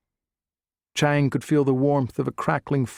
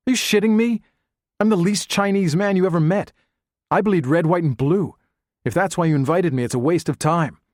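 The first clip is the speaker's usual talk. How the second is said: higher and louder, scoffing and fed up; indignant outburst; 6 semitones higher, 4 dB louder than usual. Acted. Chang scoffing at Carlos for calling him a son of China, loud indignant retort. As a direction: throw the idea back at him, insulted. Chang snorts, rejects Chinese label.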